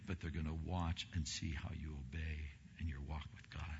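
hushed confiding whisper; soft, unvoiced aside to the congregation. Real, not acted. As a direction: drop to a whisper to drive it home. Confiding point to parents.

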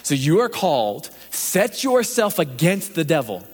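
usual speaking voice

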